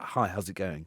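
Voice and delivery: a light posh-schoolboy greeting; mock polite and meek; public school voice. Mocking a teammate's public school manners, lightly posh. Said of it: "he's so public school".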